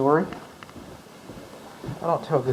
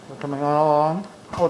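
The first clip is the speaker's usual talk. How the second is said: ordinary talk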